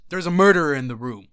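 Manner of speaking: a touch of a staged warning; mock declaration. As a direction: proclaim it to the room. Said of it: "They were like".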